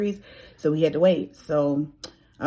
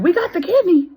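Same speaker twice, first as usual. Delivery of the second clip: "We got the kidney." repeated much higher and louder, bursting on "kidney"; thrilled echo; 14 semitones higher, 7 dB louder than usual. Retold or imitated. A patient echoing the hospital's call, thrilled and much higher. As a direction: shout it back in disbelief and joy. She echoes the news, thrilled.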